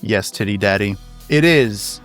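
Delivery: ordinary delivery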